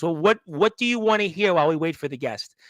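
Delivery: normal talking voice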